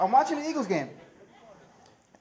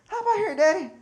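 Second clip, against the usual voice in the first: put-on high, loud little kid's voice; little girl voice; 13 semitones higher, 9 dB louder than usual. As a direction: chirp it like a toddler. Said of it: "She said".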